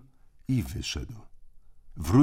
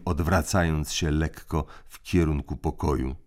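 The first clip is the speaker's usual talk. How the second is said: usual speaking voice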